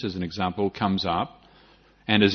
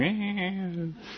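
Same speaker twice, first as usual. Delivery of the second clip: put-on high, softer baby "eh"; mock infant bleat, pitched way up; imitated baby noise; 11 semitones higher, 6 dB quieter than usual. Imitating baby's wordless response.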